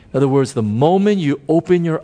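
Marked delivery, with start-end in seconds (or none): none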